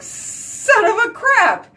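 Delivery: high, slow mock outrage at the cold; hammed-up high wail of disbelief; exasperated shock. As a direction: act out waking up to a freezing morning. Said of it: "I get up and I'm like".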